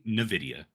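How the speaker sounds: "nvidia" with a "nuh" opening; mispronounced Nvidia. Retold or imitated. Co-host insists his partner says it so, "nvidia" as nuh-VID-ee-uh. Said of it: "I feel like you say nvidia".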